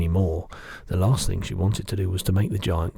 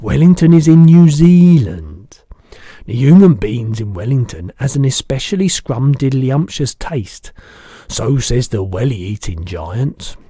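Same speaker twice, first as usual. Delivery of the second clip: the giant's voice; 5 semitones higher, 12 dB louder than usual. Acted. Giant correcting the girl, big booming voice.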